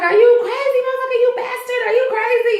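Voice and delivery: high, outraged yelling at her attacker; mock furious yell. Marcy screaming at Randall, retold.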